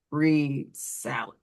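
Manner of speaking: "read salad" said slowly and clearly; slow teacher prompt. Shown as a demonstration. Teacher prompting a student to reread salad, slow and clear. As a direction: coach the child to reread, unhurried. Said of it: "Point to the left of the word".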